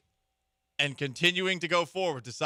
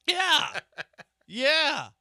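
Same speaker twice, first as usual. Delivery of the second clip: high laughing yeah; 12 semitones higher, 6 dB louder than usual. Real, not acted. Laughs at Doubtfire joke.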